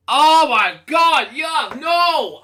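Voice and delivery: loud, high, slow "yuck, no"; disgusted outcry. Real, not acted. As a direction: recoil from the food in disgust. Disgust at the peanut dinner.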